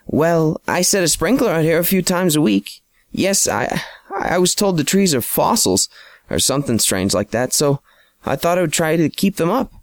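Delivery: a lightly voiced fretful homeowner; Miss Simmons, apologetic homeowner.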